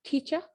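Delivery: "teacher" said as "teach-uh"; silent R. Shown as a demonstration. Teacher modelling for students, "teacher" with silent final R. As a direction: end "teacher" on an open vowel, no R. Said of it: "कैसे बोलेंगे?".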